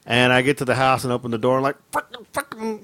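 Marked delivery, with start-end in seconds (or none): 1.9-2.8 high, soft muttered cursing; stung man muttering to himself, high; pained muttering. Retold or imitated. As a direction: grumble the curse under breath. Muttering curses after a sting.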